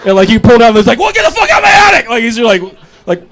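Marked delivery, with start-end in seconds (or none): 1.0-2.1 high, fast frantic yelp; crazed attic brother. Acted. Meth-selling brother chasing someone out of his attic, crazed high yelp. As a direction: yelp it fast, far above your own pitch.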